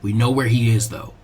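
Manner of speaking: put-on a detached boy reporting the facts; low, quiet, matter-of-fact youth; cool Sasuke.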